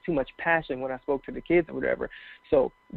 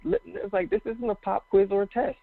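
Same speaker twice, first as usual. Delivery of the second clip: amused exasperation; 6 semitones higher than usual. Retold or imitated. Exasperated aside to the student.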